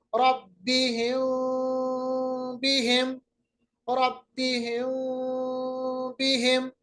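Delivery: meem of बिहिम hidden, lips just touching; light hidden meem. Shown as a demonstration. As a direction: ikhfa demo repeated. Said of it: "हिम नहीं पड़ा".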